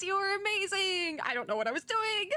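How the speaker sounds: high, giddy squeal; giddy excitement. Acted. Book character praising the player after the fight, giddily excited. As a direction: gush in a high voice, still buzzing from the win.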